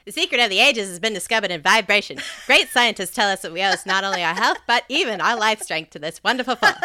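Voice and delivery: fast, loud vintage radio ad pitch; old-timey ad voice. Shown as a demonstration. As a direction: sell it like a snake oil salesman. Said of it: "an ad lady voice".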